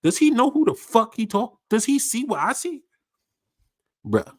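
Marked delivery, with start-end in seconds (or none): 0.0-2.8 higher, baffled protest; asking if he sees her right; incredulous. Retold or imitated. Reacting to the simp, higher disbelieving question. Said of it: "I'm like".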